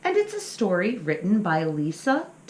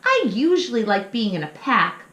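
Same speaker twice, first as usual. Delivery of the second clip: grumbling little pup; 5 semitones higher, 7 dB louder than usual. Acted. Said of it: "Little Pump grumbled".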